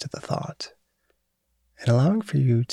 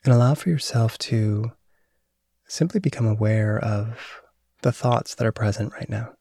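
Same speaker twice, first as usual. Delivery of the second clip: guided meditation. Acted. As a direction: calmly guide awareness of thought. Leads a mindfulness meditation.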